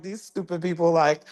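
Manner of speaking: mildly annoyed retelling; emotional. Retold or imitated. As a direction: huff about stupid people. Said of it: "I was more emotional".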